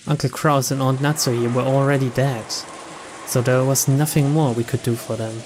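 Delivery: ordinary talk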